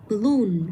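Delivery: balloon with LOON stressed and falling; stress on second syllable. Shown as a demonstration. Teacher modelling for a student, balloon stressed on LOON. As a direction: say balloon leaning on the double-O syllable. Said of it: "mình cũng sẽ nhấn trực tiếp vào".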